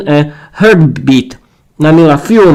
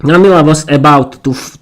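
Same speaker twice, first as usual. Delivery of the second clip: ordinary delivery, nothing marked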